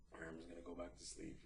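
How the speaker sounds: very soft and quick; whispered to himself. Retold or imitated. Settling back into bed unnoticed, whispered. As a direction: sneak it out under your breath.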